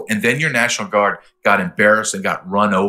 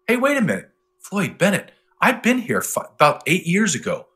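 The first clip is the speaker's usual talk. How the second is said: much higher, startled realization; sudden recognition; 7 semitones higher than usual. Retold or imitated. Retelling driving to Floyd Bennett Field, surprised. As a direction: pitch jumps up in surprise. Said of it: "I'm like".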